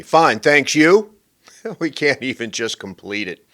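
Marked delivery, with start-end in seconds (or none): 0.0-1.1 loud, higher brush-off; mock dismissive small talk; get-away-from-me reply. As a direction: toss back the pleasantry to get rid of them. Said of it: "The ultimate "get away from me".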